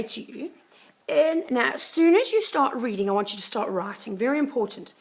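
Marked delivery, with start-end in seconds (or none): none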